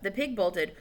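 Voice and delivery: plain reading voice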